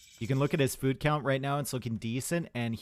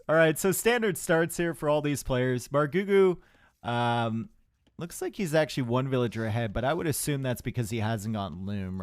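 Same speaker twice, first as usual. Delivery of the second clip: ordinary talk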